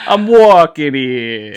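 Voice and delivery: put-on slow, loud New York tough guy accent. Jumping in with the classic line, loud New York accent. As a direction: bark it like a cabbie crossing the street. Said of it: "you know that?".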